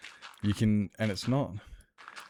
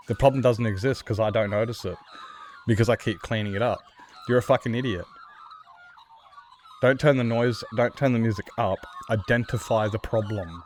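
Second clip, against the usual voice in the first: loud, heated rant at careless drivers; loud frustrated rant to the audience; angry rant; 2 semitones higher, 5 dB louder than usual. Real, not acted. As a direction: build up louder and louder.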